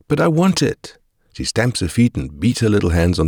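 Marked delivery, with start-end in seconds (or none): 0.1-1.0 put-on much higher, pleading kid's protest; whining child. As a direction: whine it like a toddler about to stamp. Child protests, stamps her feet.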